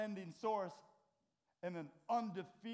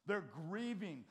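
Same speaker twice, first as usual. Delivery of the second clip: ordinary talk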